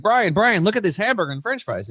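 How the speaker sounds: excitedly grabbing his attention, imitated; imitated eager professor. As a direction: act out someone rushing up to show a find. Professor runs up, urging Brian to look.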